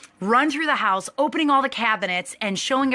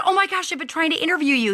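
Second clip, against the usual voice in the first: excited gasp; 8 semitones higher than usual. Retold or imitated. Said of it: "And I said".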